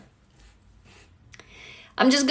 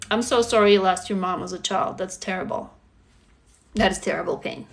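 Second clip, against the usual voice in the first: lower, softer and gentle; tender sympathy; 6 semitones lower, 4 dB quieter than usual. Real, not acted. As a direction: comfort someone who lost their mom. Consoling a viewer's loss.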